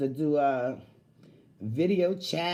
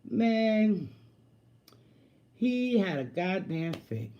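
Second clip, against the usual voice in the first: ordinary talk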